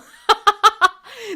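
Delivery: loud, high burst of laughter; big real laugh; cracking up. Real, not acted. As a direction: crack up helplessly mid-sentence. Cracks up mid-sentence.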